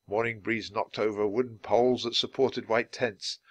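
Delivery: ordinary delivery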